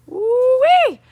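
high, loud whoop; excited whoop. Real, not acted. Vlogger whooping to viewers about his motorcycle glasses, loud excited whoop. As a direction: let out a big happy holler.

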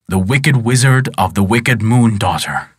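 plain reading voice

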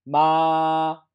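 MA held high, level and loud; first tone. Shown as a demonstration. Teacher models tone one for students, high level 마. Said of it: "같이 해봐요".

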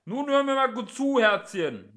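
high, loud policeman's holler; shouted, condescending warning; megaphone voice. A narrator's reading. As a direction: blare it out as if through a megaphone. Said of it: "sagte die Megafonstimme".

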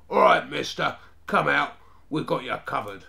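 loud, challenging hold-up; forceful command, easing at the end; barked order. A narrator's reading. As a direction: order him out like a young gangster. Gang ambushes boy, orders him out.

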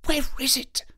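intense, strained whisper; shaken, high-strung whispered question; urgent whisper. A narrator's reading. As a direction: whisper it urgently, peering for the source. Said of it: "Holmes whispered".

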